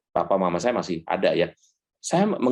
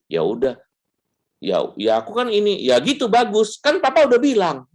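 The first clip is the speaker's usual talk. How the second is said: smug parent; 11 semitones higher, 4 dB louder than usual. Retold or imitated. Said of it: "sebel banget kalau dibilangin".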